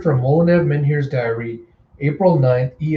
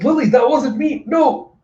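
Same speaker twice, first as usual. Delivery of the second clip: much higher, acted alarm; panicked protest; 10 semitones higher, 4 dB louder than usual. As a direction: plead in a hammed-up high-pitched panic.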